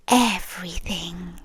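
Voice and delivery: a creepy hushed answer; ominous whisper. Acted. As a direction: breathe "Everything" like a secret. Ominous one-word reveal.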